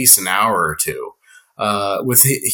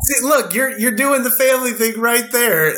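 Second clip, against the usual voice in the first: bursting with excitement, much higher pitch; excited; 11 semitones higher than usual. Real, not acted. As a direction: gush with real delight, high and bright. Excited praise of host's family thing.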